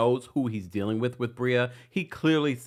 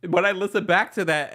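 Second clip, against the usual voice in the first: ordinary talk, nothing marked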